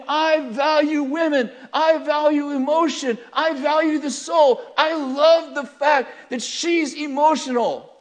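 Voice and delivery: loud, high, slow, emphatic preaching; impassioned declaration. Acted. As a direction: build each statement at full voice. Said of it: "Jesus is saying".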